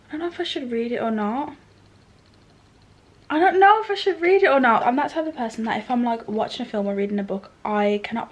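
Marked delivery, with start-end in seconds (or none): 3.3-4.9 higher and louder, dramatic despair; exasperated outburst. Real, not acted. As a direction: throw the line out in mock agony. Repeats dilemma, second louder.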